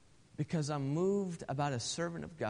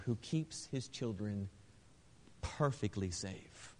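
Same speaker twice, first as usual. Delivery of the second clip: hushed reassurance; 3 semitones lower than usual. Acted. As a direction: settle them gently into safety. Hushed turn after shouting.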